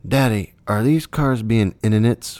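a hint of an indignant preschooler; imitating small son. Small son asking dad in the drive-through line, mild kid imitation. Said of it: "which is his way of saying idiots".

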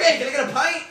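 high, loud put-on tavern voice; character voice. Minotaur hamming it up to the barkeep, high and loud. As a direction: order a pint in a hammed-up voice. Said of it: "I'm gonna be like".

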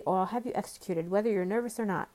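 usual speaking voice

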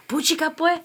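high, lilting Central American puchica; Guatemalan slang. Shown as a demonstration. Teaching a Guatemalan word, high lilting accent. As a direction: sing out Puchica, pues like a Guatemalan. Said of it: "they do puchica".

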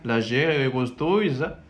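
"gustosa" drawn out slowly with a juicy G; relished G. Shown as a demonstration. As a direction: savour the G in "gustosa". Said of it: "Un poquito así con la G".

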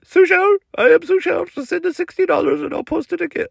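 high-pitched put-on salesman voice; high, strained character voice, stretched at the end; tech guy Sushel. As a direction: pitch it way up as the Instagram promoter. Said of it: "what's your name again?".

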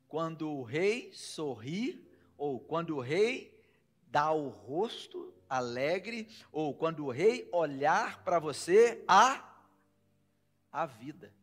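slow, drawn-out reading; dramatic scripture reading. Acted. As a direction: stretch each word of the verse. (leitura dramática e lenta)

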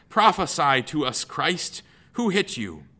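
loud, high jeering; mocking taunt. Guards mock Jesus while beating.

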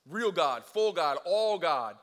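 loud, punchy build; preacher's rising emphasis; emphatic. Real, not acted.

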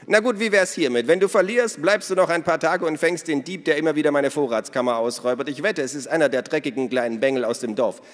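loud, high, hearty woman's voice; boisterous Minna. Acted. Minna tells the children about their father, boisterous. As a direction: play her big and bold.

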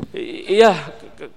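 stuttering in mock fright; a frightened worker, held at knifepoint, stammering his answer; mock scared stammer. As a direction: stammer out the yes like a terrified gardener.

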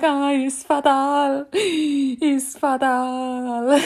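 high, loud, stretched-out mock cry; hammed-up imitation of mum; mum's dramatic wail. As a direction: gasp and wail as if mortally wounded. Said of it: "that's always stuck in my head".

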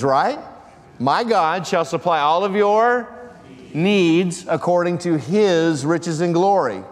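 full-force preaching. Acted. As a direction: press the question harder each time.

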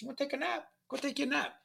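put-on high, gentle old-man nudge; sponsor's gentle voice. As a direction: coax a tired friend into a nap. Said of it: "He said".

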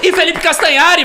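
high, loud announcement; announcer call. Acted. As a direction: present him with fanfare. Announcing second guest. (anunciando alto)

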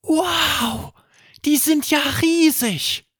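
put-on high, amazed boy's voice; amazed child. A narrator's reading. Erik sees the giant apple trees, high and amazed. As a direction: gasp it out like a wide-eyed boy.

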